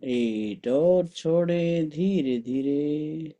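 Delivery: slow, drawn-out counting on an even tone; guided breath count. Acted. Teacher counting breath holds for the group, guided meditation. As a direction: stretch each number evenly, pacing the held breath.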